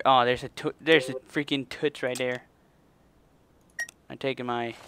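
ordinary delivery

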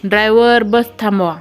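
usual speaking voice

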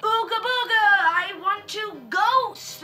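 haunt them with hammed-up menace; mock spooky ghost. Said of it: "then the ghost comes".

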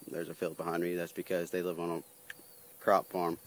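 ordinary talk, nothing marked

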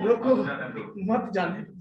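drawn-out rising "रोको", long break, then "मत जाने दो" together; pause after रोको. Shown as a demonstration. Last courtier misreads the order, pausing after रोको. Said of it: "दरबारी ने क्या पढ़ा पता है?".

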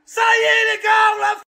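high, yelled celebration; ecstatic shouting. Real, not acted. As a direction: scream with joy at the goal. Celebrating a goal.

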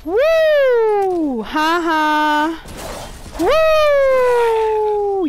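high, loud victory cheer; triumphant whoop. Real, not acted. Gamer cheering after killing the monster, triumphant. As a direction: whoop with relief.

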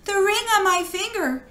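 small high-voiced offer; timid girl, pitched high. A narrator's reading. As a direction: say it like a frightened girl. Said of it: "answered the girl".

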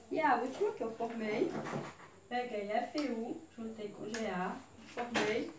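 usual speaking voice

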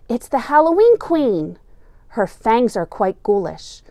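ordinary delivery, nothing marked

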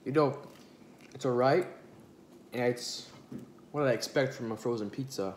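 chewing between words; talking while eating; mouth partly full. Real, not acted. Seen 3: bites pizza at 1.2s, then talks with food in mouth.

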